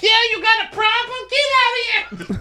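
very high squeaky yelling voice; Fatum yelling. Retold or imitated. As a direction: shriek it at the door.

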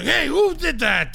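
high, drawn-out mock shout; feigned outrage. Dad turning round after his own prank, mock outraged shout.

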